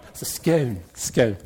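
"scone" rhyming with "stone", after the English fashion; English pronunciation. Shown as a demonstration. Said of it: "as my English friends say".

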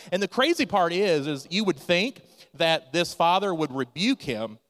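usual speaking voice

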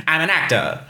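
put-on high, bright old Hollywood film voice; old-timey transatlantic accent. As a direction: speak up and clipped like a thirties movie star. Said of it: "that old-timey voice that I do".

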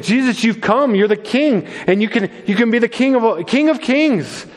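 a pastor voicing Satan offering Jesus the crown, high and coaxing; tempting Satan. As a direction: pitch it up and sweet-talk like the devil dangling a prize. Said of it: "He's saying".

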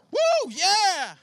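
loud, high whoop of celebration; big happy whoop; excited cheer. Real, not acted. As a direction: cheer it out like a rally. Cheering for the youth group.

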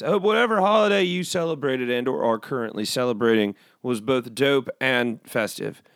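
normal talking voice

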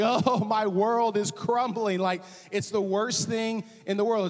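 a kid told there are no chicken nuggets, mock despair; mock toddler meltdown. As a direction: overplay a child's tragedy with a laugh. Voicing a toddler denied nuggets.

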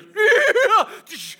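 loud, very high neighing squeal; imitated horse whinny. Pastor imitates six stampeding horses, loud shrill neigh.

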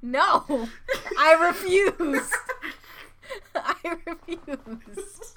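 drawn-out mock refusal through laughter; dramatically stretched, giggly defiance; mock wrath. Host refusing to finish the sentence, in mock wrath. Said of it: "invoked my wrath".

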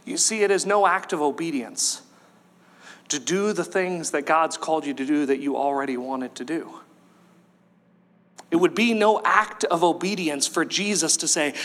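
ordinary talk, nothing marked

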